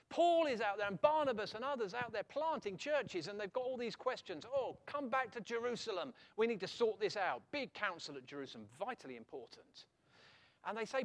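loud, rising preacher's voice; fervent preaching. Acted. Preacher stirring up the congregation, loud and fervent. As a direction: preach it out to the whole church.

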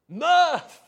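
Mirth! bellowed very loud and high, falling at the end; full-throated shout of joy at the congregation. Real, not acted. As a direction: blast Mirth out like an unexploded bomb going off. Preacher bursts out the psalm's word.

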